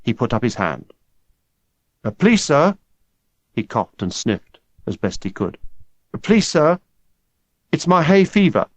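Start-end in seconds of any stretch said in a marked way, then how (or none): 2.0-2.8 schoolboy voice. Acted. Boy faking hay fever. 6.1-8.7 schoolboy voice. Acted. Boy faking hay fever.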